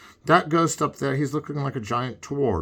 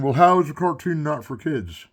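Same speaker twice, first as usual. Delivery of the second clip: ordinary talk, nothing marked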